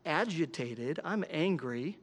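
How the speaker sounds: softly admitting fault to himself; hushed, rueful self-talk; quiet realization. Retold or imitated. Pastor voicing his own thoughts, quietly rueful. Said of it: "I just start realizing".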